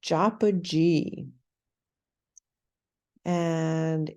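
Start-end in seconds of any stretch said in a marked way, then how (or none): 0.0-1.3 slow, careful "Japuji" with a short u in the middle; Japuji said slowly. Shown as a demonstration. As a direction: spell out "Japuji" sound by sound. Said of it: "And so".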